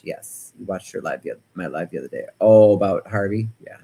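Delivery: ordinary delivery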